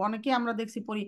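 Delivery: ordinary talk, nothing marked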